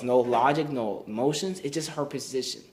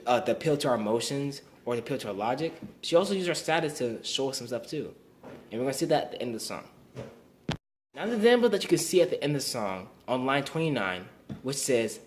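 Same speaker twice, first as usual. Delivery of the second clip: ordinary delivery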